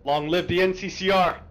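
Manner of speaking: rally the troops before the charge; loud gung-ho soldier. Real, not acted. Battle rally cry before the attack.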